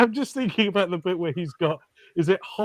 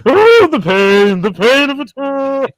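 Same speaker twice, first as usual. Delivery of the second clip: loud, high theatrical wail; old mystic mantis; 10 semitones higher, 12 dB louder than usual. Retold or imitated. Fan imitating Manny the mantis for co-hosts, hammed-up old mystic. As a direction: moan it slowly and grandly, hammed-up agony. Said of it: "this weird old mystic praying mantis".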